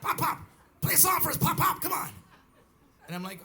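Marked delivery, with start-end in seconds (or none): 0.0-2.1 put-on high, loud kids nagging relentlessly; imitated kiddie clamor, pitched way up; hyped-up grandkids. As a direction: badger like over-sugared children. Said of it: "they were spinning like dreidels".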